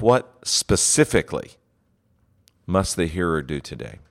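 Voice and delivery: "What specifically" leaned on with a falling tone, then a pause; stress on specifically. Shown as a demonstration. Preacher pondering his sermon, stressing "specifically". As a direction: hit "specifically" hard, then let the rest drop. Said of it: "by inflecting different words".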